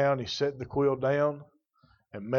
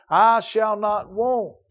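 "I shall not want" slow and higher, "I" stressed; triumphant slow declaration; emphatic refrain; 7 semitones higher, 5 dB louder, much slower than usual. Shown as a demonstration. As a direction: lift it and stretch each word. Preacher climaxing the refrain.

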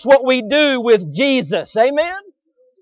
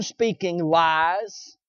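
usual speaking voice

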